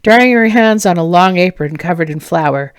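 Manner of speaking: plain reading voice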